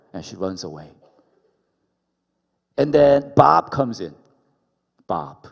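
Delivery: ordinary delivery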